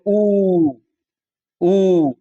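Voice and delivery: a long, rounded ऊ held out, said twice; long u sound. Shown as a demonstration. Teacher demonstrates the back vowel ऊ to students. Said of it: "बोल के भी देख लो".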